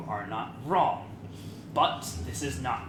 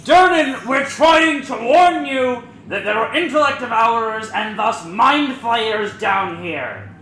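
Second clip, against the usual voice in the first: shouting up to the barkeep, much higher and louder; yelling up; 13 semitones higher, 16 dB louder than usual. Acted. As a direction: call it up the shaft at full volume. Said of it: "why the hell are we yelling".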